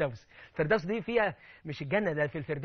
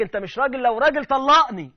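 higher, louder, slowing into a shrill demand; mock a furious wife daring him; imitating angry wife; 6 semitones higher, 12 dB louder than usual. Preacher voicing a wife provoking her husband, angry. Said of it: "زوجتك تقول لك".

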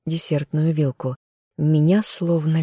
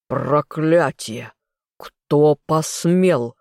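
slow, drawn-out furious growl; weighty, outraged bellow, narrated; roaring fury; much slower than usual. A narrator's reading. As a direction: roar it slowly like an outraged father. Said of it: "взревел он".

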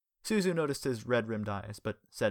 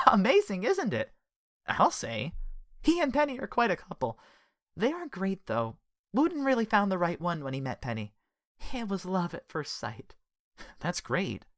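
higher, lightly voiced woman; gossipy friendly woman; 10 semitones higher, 3 dB louder than usual. Connie's voice.

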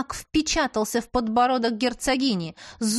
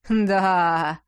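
triumphant drawl; 3 semitones lower, 4 dB louder than usual. A narrator's reading. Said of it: "победоносно протянула".

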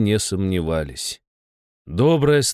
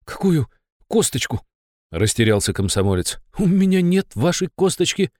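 shaky voice; 6 semitones higher than usual. Acted. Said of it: "Голос у парня дрогнул".

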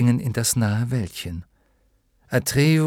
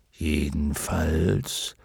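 slow, low dragon voice; dignified luck dragon; 5 semitones lower, 4 dB quieter, much slower than usual. A narrator's reading. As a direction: cut in deep and drawn out as a dragon. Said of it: "mischte sich nun wieder Fuchur ins Gespräch". (tiefe, gedehnte Drachenstimme)

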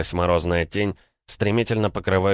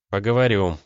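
usual speaking voice

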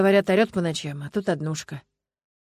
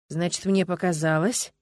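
ordinary delivery, nothing marked

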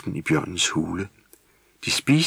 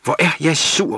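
loud, higher cross yell; grumpy shout; 9 semitones higher, 5 dB louder than usual. A narrator's reading. Grumpy little gnome shouts at home. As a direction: stamp and shout it out. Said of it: "råbte Vrisnis".

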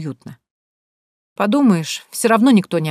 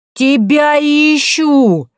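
slow, high, loud yell; 9 semitones higher, 7 dB louder, much slower than usual. A narrator's reading. Barbara yelling at the doorman across the street, slow loud yell. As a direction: bellow it to the whole street. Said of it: "завопила на всю улицу".

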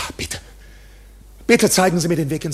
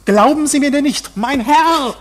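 insistent, raised voice, much higher; loud protest; 8 semitones higher, 9 dB louder, slower than usual. Acted. Accused man pressing the judge, loud and insistent. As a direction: press him hard, almost shouting.

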